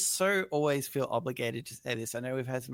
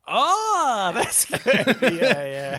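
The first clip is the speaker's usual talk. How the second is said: high, loud laughing delight; real laughing approval; 8 semitones higher, 10 dB louder, much slower than usual. Real, not acted. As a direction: crack up at the joke. Reacts to joke reply.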